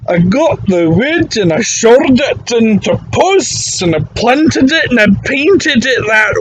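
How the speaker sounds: put-on a whiny proud old Scotsman bragging about his fence; old Scottish barfly. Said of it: "He goes".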